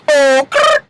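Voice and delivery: high, rising okay; another practice take. Shown as a demonstration. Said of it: "I gotta practice on that".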